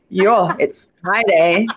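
imitating an Afrikaans chiropractor, slowed; light Afrikaans accent, drawn out. Mimicking the chiropractor's line to the host in a light Afrikaans accent. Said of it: "in his Afrikaans accent".

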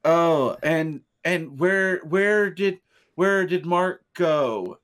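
slow, halting cue-card reading; lightly imitating a bad reader, slowly; stilted reading. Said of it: "and they're like".

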